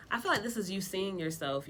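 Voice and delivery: ordinary talk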